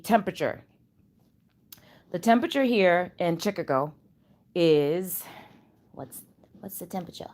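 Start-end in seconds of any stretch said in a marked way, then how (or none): 5.9-7.3 very soft, quick aside; barely audible, hurried question; hushed whisper. Real, not acted. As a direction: whisper it so the device won't hear. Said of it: "I gotta whisper".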